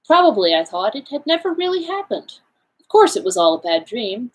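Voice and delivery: ordinary delivery, nothing marked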